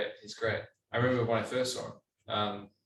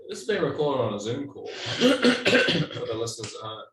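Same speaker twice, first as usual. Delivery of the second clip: mock announcer; 3 semitones higher, 5 dB louder, slower than usual.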